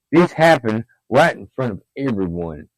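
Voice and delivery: plain reading voice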